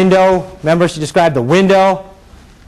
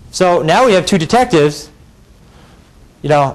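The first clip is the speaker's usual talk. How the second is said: usual speaking voice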